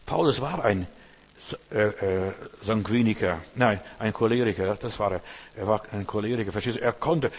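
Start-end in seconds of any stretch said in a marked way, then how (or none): none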